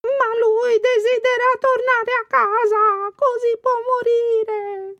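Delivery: high, slow, tearful pleading; desperate crying. A narrator's reading. Aunt Ply weeping and begging the frog-girl to free her nephew. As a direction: beg through tears, very high. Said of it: "disse zia Ply".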